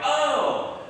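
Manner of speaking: high, loud "oh" of surprise; dawning realization. Retold or imitated. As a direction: re-enact the penny dropping. Retells realizing who sent the check.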